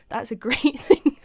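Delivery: giggling while talking; laughing. Real, not acted. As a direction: laugh through the words. Laughing about capsizing.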